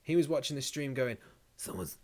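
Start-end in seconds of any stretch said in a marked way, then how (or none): 1.6-2.0 a hint of a Trump impression, hushed. Streamer imitating Trump watching the stream, lightly voiced. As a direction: quietly put on the president's voice. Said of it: "I'm doing the same voice".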